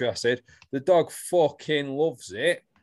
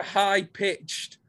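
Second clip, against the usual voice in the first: mock Australian accent, pitched up and drawn out; slow, higher-pitched Aussie impression; 4 semitones higher, much slower than usual. Shown as a demonstration. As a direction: slip into a silly high Australian voice. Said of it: "high-pitched Australian voice like that".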